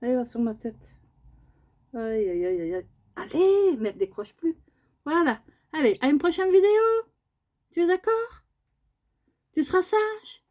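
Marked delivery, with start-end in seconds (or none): 3.2-10.4 high sing-song pet talk; very high cutesy coaxing. Real, not acted. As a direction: sweet-talk the bird at the sign-off.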